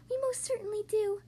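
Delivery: high little creature voice; piping tiny voice. A narrator's reading. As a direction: pipe it high as someone tiny. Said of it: "piped the voice".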